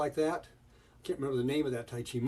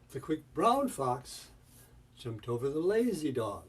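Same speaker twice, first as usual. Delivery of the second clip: BROWN and LAZY each swooping up and back down; rise-fall emphasis. Shown as a demonstration. Teacher emphasizing the colors, rise-fall swoop on "brown" and "lazy". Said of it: "rise and fall of the melody".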